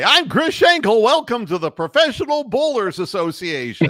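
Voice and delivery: hammed-up bowling broadcaster intro; booming mock sportscaster welcome; Chris Schenkel. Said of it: "and the announcer would say".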